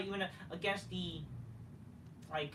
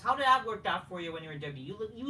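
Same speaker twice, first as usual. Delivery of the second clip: normal talking voice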